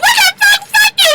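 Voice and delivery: very high, loud shriek of rage; acted screaming fury. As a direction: shriek it at him. Voicing Big Lyrica exploding.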